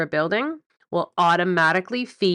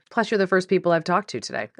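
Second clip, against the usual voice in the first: normal talking voice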